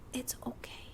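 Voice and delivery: soft, breathy whisper of comfort; a hushed it's okay; whispered reassurance. Acted. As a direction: whisper it like calming someone down. Reassuring an anxious script supervisor.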